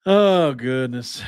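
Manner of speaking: ordinary talk, nothing marked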